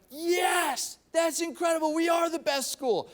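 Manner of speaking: high, shouted crowd celebration; triumphant cheer. Retold or imitated. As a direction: cheer like elated fans. Imitating Purdue fans cheering.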